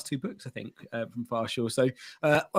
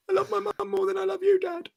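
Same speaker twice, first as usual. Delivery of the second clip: put-on high, kiddy voice for the child's verdict; child telling dad he loves mum more; mock child voice. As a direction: play the little kid choosing mum, pitched way up. Child's voice in retold bedtime.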